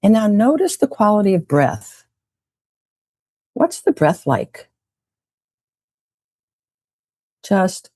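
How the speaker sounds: guided meditation. Acted. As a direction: lead the group gently into a relaxed memory. Leads a guided breathing meditation.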